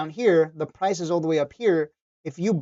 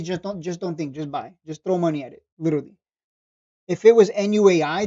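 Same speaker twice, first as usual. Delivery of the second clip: ordinary talk, nothing marked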